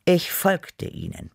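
ordinary delivery

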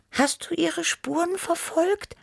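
very high, eager little question; small high character voice; high-voiced tiger character. A narrator's reading. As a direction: ask in a squeaky little cat voice. Tibi's figure voice in story.

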